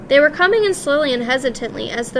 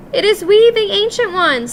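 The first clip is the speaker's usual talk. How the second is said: high, timid little voice; meek voice; 8 semitones higher, 5 dB louder than usual. A narrator's reading. Old witches timidly announcing themselves at her door. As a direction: shrink back and announce yourselves meekly. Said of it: "said a meek voice".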